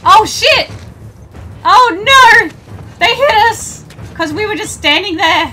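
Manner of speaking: high, loud frantic cries, ending laughing; panicked yelling. Real, not acted. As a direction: shriek in panic then laugh. Attacked with thrown objects.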